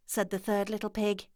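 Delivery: plain reading voice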